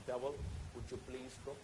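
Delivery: mock-genteel soft request; lightly voiced prim Englishman asking nicely; polite English gentleman. Foreign missionary politely begging the devil, a hint of English gentleman. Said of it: "Englishmen are, they're very paka gentlemen".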